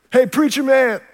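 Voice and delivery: a thug mocking the priest in a pub; taunting thug.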